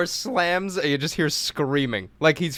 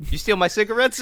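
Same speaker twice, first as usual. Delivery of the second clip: higher, cheeky challenge; mock accusation; 8 semitones higher, 4 dB louder than usual. Teasing a huge coworker with a cheeky accusation. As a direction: needle him like a tough guy.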